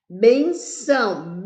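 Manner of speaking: "Benção" slowly, BEN up and ÇÃO down; stress on BEN. Shown as a demonstration. Teacher showing which syllable rises, "Benção" slowly with stressed BEN. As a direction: lift the BEN of "Benção", let ÇÃO fall. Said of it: "Vejamos que o bem subiu".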